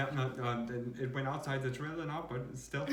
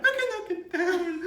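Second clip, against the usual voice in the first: high, louder wail through laughter; laughing mock wail; 8 dB louder than usual. Insisting it was terror, wailing it again laughing. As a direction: wail it and crack up. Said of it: "that was the terror of my life".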